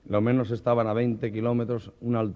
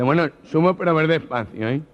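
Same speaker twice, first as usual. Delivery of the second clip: loud, high nervous nasal voice; gangoso; 5 semitones higher, 5 dB louder than usual. Acted. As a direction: give in warily.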